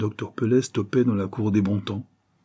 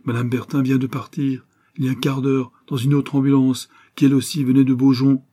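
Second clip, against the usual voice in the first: alarmed insistence; 5 semitones higher, 5 dB louder than usual. Acted.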